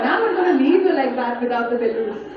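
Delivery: ordinary talk, nothing marked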